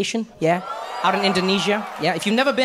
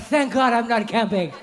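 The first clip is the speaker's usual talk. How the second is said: relieved sigh; 3 semitones higher, slower than usual. Retold or imitated. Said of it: "I'm like".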